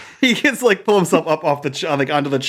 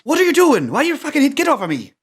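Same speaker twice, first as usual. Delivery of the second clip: startled angry outburst; 8 semitones higher than usual. Acted. Man slapped awake shoves off his rescuers, high angry outburst. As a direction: cry out as if just slapped awake.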